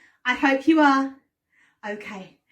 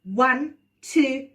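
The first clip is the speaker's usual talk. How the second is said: put-on kids party magician; 3 semitones lower, 3 dB louder than usual. Storytelling class host voice.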